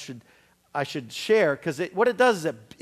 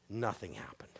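whispered letdown; deadpan whisper; 10 semitones lower, 15 dB quieter than usual. Acted. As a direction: drop it to a flat whisper. Anticlimax after the prayer.

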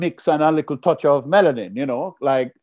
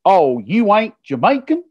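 higher, incredulous American heckler; heckler's voice; 6 semitones higher than usual. Retold or imitated. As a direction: challenge him like a doubting stranger. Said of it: "a man jumps up and says".

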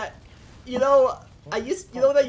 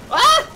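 high, loud scream of fright; frightened scream; 9 dB louder than usual. Real, not acted. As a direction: scream when suddenly attacked. Sudden zombie attack.

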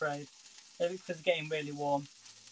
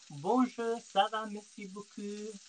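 playful French; 4 semitones higher, slower than usual. Pretend. Breaks into French phrases.

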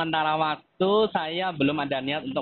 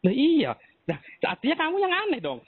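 mocking retort; 10 semitones higher than usual. Real, not acted. Debtor turns blame on collector.

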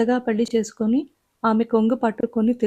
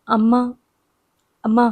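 put-on narrated child's cry for mother, slightly louder; tearful call; 4 dB louder than usual. A narrator's reading. Little girl crying for her mother, understated tearfulness. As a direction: call out with a touch of tears, pausing between. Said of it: "ఏడుస్తూ అంది".

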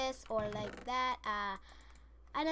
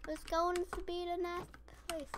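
ordinary talk

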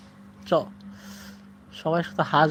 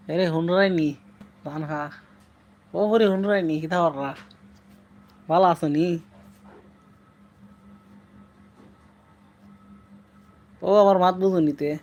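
Sylheti dialect. Shown as a demonstration. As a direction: speak it in broad Sylheti, casual and chatty. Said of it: "Sylheti ভাষায় বলো". (সিলেটি টানে কথা বলা)

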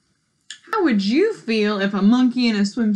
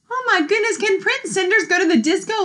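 high amazed exclamation; surprised; 8 semitones higher, faster than usual. Acted. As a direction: gasp it out in wonder. Reacting to the monkey prince.

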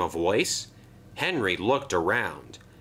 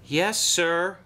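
a meek, whining yes sir; whimpering; 8 semitones higher than usual. A narrator's reading. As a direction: shrink back like a scolded engine. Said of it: "whimpered Henry".

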